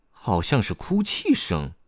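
puzzled little discovery; surprised. A narrator's reading. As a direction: say it with wondering surprise. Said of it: "卡梅利多惊讶地说".

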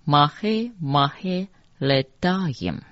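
Mahe Mahe Ledayim, slowly syllable by syllable; mantra pronunciation. Shown as a demonstration. Teacher reading line twenty-six for learners, slow pronunciation demo. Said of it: "再一次".

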